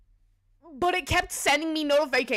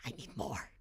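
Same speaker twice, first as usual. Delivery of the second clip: breathy whispered craving; hushed mock-greedy whisper; 13 semitones lower, 13 dB quieter than usual. Joking about gluttony.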